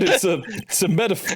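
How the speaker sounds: usual speaking voice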